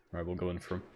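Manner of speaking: ordinary talk, nothing marked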